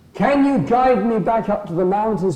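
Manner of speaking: high, hopeful request; eager mouse. Acted. Mouse asks the wolf to guide him, high and eager. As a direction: ask it high and keen.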